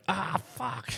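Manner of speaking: high, hushed groan of a curse; blurted in hushed disbelief; dismayed curse. Retold or imitated. Cop partner seeing the ghost hearse, high hushed curse.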